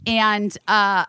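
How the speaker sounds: ordinary talk, nothing marked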